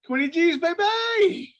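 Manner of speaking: hammed-up Irish shout quoting a fighter; Conor McGregor impression. Podcast host quoting Conor McGregor to his co-host, mock Irish shout. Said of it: "to quote Conor McGregor".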